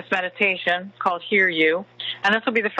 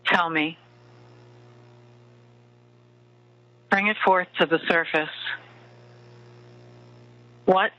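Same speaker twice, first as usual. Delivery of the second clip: right in your ear. Acted. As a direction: murmur close like a lover at bedtime. Leads a bedtime love meditation.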